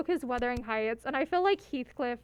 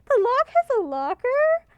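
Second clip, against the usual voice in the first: high, loud, baffled; puzzled disbelief; baffled question; 10 semitones higher, 7 dB louder, slower than usual. Real, not acted. Baffled by game text.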